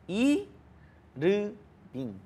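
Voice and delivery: "I, Ru, Bin" as three separated beats; Korean syllable-by-syllable pronunciation. Shown as a demonstration. Tutor repeating friend's Korean name, broken into syllables. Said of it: "cómo se pronuncia en coreano".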